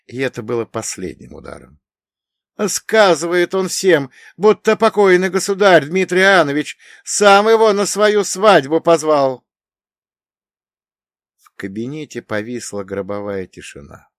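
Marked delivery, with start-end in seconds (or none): 2.7-9.5 terrified. Acted. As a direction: spell out the worst news, afraid. Terrified messenger reports news. (со страхом)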